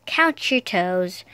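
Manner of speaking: ordinary delivery, nothing marked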